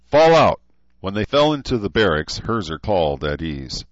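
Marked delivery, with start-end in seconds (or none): 0.1-0.6 barked drill order; shouted command. Acted. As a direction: yell it at the troops.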